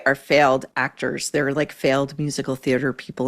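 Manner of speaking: ordinary delivery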